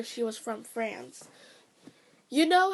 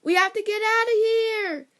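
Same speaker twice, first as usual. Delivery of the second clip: panicked scream, much higher and louder; 10 semitones higher, 8 dB louder than usual. Retold or imitated. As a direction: shriek it out in a panic. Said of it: "hear Minnie scream".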